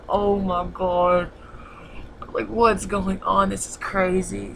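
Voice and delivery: slow, stretched-out mock disbelief; replayed astonishment, words dragged slowly; retold shock. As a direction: draw it out, mildly stunned, as if reliving it. Retelling own shocked reaction to punch.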